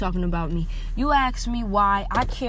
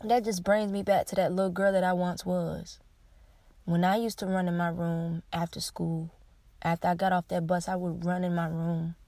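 usual speaking voice

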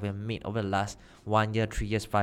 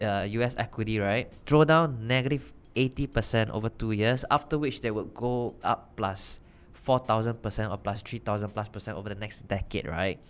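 usual speaking voice

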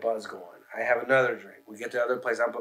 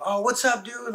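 higher-pitched imitated greeting; lightly voiced friendly bartender; 10 semitones higher, slower than usual. Bartender greeting a friend, imitated high hello.

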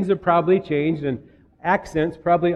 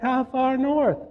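high-pitched imitated Southern twang; mock Southern accent; 9 semitones higher, slower than usual. Tennessee local pressing the newcomer in a mock Southern accent. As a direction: question him as a hammed-up Southern local. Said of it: "that was a terrible accent".

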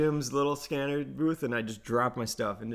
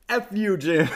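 loud parting insult; 8 semitones higher, 7 dB louder than usual. Retold or imitated. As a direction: shout it with a laugh. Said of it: "as I'm backing away".

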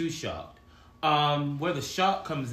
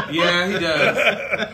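laughing through a slow, drawn-out yeah; real laugh at being teased; 6 semitones higher, 8 dB louder, much slower than usual. Real, not acted. Laughing while the coach's post gets described.

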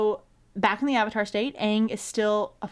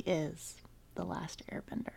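breathy whisper of a sad moment; whispering the sad part; hushed whisper; 4 semitones lower, 15 dB quieter than usual. Real, not acted. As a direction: drop to a whisper, moved. Sad recap, unvoiced.

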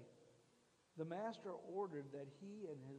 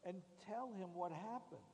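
louder, pressing the outrage; fiery preaching; 4 semitones higher, 6 dB louder than usual. Acted. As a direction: preach it hard to the crowd. Preaching at full force.

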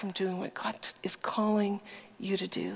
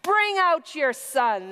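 raised-voice order from a mob; loud public demand; 7 semitones higher, 6 dB louder, slower than usual. A narrator's reading. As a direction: demand it forcefully, louder. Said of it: "the men of the town demanded".

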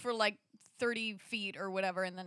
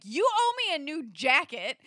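loud, higher indignant demand; raised, aggrieved retold voice; angry friend, imitated; 5 semitones higher, 8 dB louder than usual. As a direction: demand payback from a friend, mock-cross. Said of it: "my friend is now angry at me".

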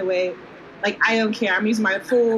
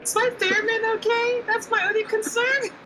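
much higher, fussing voice; worried musician pitched way up; high-pitched fretting; 13 semitones higher than usual. Acted. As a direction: fret in a high, squeaky pitch. Player voices anxious performer fussing over theremin.

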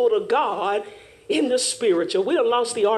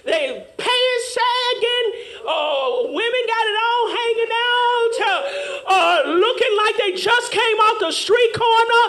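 rhythmic sermon cadence; preaching; 9 semitones higher than usual. Acted. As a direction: preach it to the pews. Preacher exhorting the congregation.

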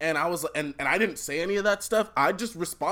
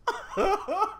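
high squealing laugh. Real, not acted. Laughing at a mocked quote.

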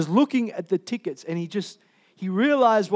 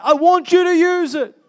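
voiced God calling out high and loud; I want you to USE it, cried out with urgency; urgent plea; 11 semitones higher, 9 dB louder than usual. Preacher voicing God pressing his children to use their gifts, urgent and loud. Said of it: "He's like that".

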